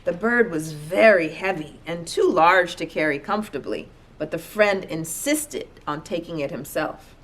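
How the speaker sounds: plain reading voice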